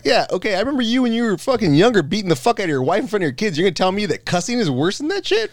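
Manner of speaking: high-pitched scolding, rising at the end; retold indignant outburst. Retold confrontation with hypocritical coworker.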